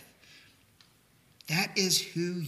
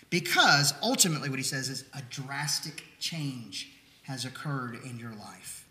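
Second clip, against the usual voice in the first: normal talking voice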